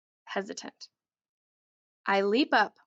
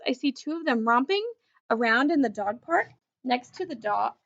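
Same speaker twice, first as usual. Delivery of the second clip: usual speaking voice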